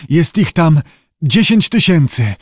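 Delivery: frightened diver's strained report; rasping. A narrator's reading. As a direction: rasp it out in dread. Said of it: "chrypiał Syngales".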